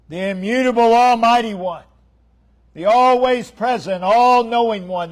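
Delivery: loud, high, rolling pulpit delivery; preacher at full cry building to "Yea, Lord"; full-force preaching. Acted. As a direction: thunder the names of God to the congregation.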